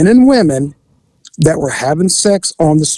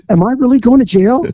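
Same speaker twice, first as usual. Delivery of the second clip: mock alarm; 7 semitones higher than usual. Suspect's alarm, retold.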